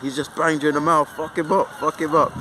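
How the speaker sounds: mock yell urging the friend to hit back; retold angry yell. Retelling how he yelled at the stunned friend, mock angry shout. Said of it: "and I was like".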